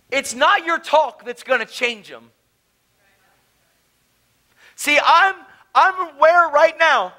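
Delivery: high, loud, urgent preacher's appeal; full-force preaching. Real, not acted. Preacher challenging the crowd about unsaved friends, full-force preaching.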